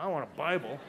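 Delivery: dry, mocking disbelief; sarcastic scoff. Alone in his cell, scoffing at the guard's Bible, sarcastic.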